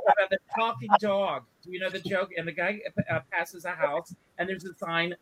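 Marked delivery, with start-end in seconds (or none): none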